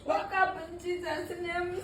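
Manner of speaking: real tearful, high-pitched prayer; crying. Real, not acted. Frightened victim praying friend awake, crying. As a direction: plead desperately through tears. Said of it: "She's still crying".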